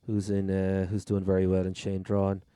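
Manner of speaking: ordinary talk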